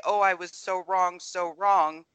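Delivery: usual speaking voice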